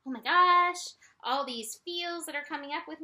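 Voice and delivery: acted gush of sudden feeling; sudden overwhelm. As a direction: exclaim it as feelings flood in. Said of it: "suddenly you're like".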